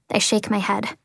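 usual speaking voice

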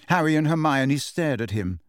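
ordinary delivery, nothing marked

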